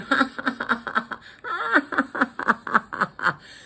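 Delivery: high, loud staccato cackle; long cackle tumbling down in pitch; villainous laugh. A narrator's reading. Chameleon gloating over his plot, villainous cackle. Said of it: "the chameleon started laughing".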